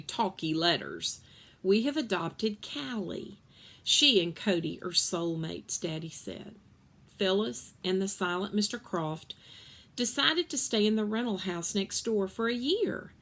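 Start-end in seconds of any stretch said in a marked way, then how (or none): none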